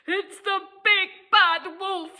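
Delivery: high, frightened piggy cry. Acted. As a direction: cry out in alarm at the wolf. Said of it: "he cried".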